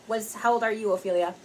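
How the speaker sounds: usual speaking voice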